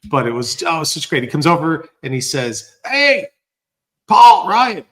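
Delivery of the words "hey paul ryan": loud, high-pitched imitated hail; Lori Wickens. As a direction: shout the names across a restaurant. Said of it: "trying to give a bit of the flavor".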